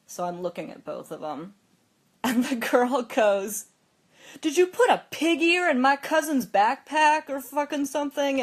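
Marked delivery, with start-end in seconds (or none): 4.4-8.4 loud, high accusing demand; imitating a furious older sister; angry yelling. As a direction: confront them in a raised, angry voice. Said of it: "ran in here yelling at you".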